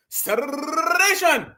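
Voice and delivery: slowed, drawn-out mocking segregation; lightly imitating how he says segregation. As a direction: stretch segregation out to mock him. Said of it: "talking about segregation".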